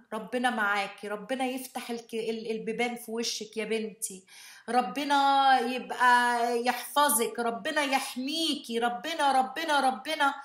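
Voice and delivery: fast, high, loud blessing in Arabic; shower blessings like a praying mother; mother blessing daughter at the door, fast and high; mom praying. Retold or imitated. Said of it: "she's praying for me out loud".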